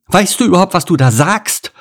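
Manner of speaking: high-pitched indignant rant; outraged, dramatic cow. A narrator's reading. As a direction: rant in outrage, building to melodramatic despair.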